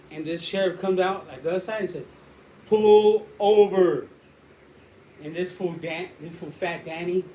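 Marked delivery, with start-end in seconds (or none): 2.7-4.1 loud, long drawn-out command; imitated police order. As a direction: mimic a sheriff calling a car to stop. Sheriff ordering car to stop.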